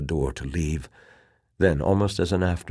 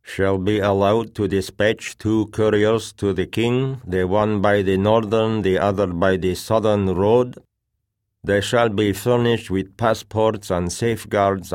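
higher, louder, monotone official reading; droning officer; 5 semitones higher, 6 dB louder than usual. A narrator's reading. Officer reading the agreement to a meeting, droning. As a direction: drone out the formal terms on one raised note. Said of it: "The droning voice of the officer reading".

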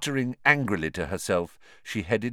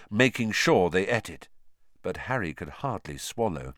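plain reading voice

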